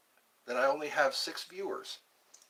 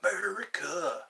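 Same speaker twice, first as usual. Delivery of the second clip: whisper; 3 semitones higher than usual. Acted. Whispered echo of America.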